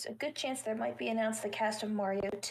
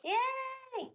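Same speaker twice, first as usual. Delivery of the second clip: excited. Real, not acted. Cheering a new subscriber.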